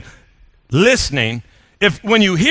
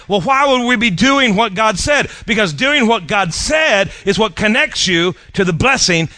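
raised, slowed preacher's cry; preaching shout; 4 semitones higher than usual. Real, not acted. Preacher pressing the crowd, shouting slowly. Preacher driving home his point.

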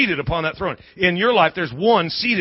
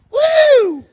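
a high, loud whoop of mock delight; whooping cheer; 9 dB louder than usual. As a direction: cheer like the crowd just scored. Cheering about a fruit diet.

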